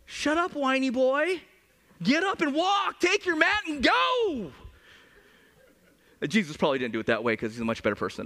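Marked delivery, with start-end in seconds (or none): 0.1-4.5 higher, slow, mock-exasperated telling-off; mock impatient. Jesus mock-scolding the whiny man, exasperated. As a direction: scold him in jest.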